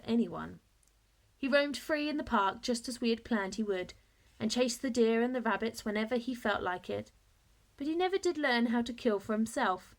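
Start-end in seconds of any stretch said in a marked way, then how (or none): none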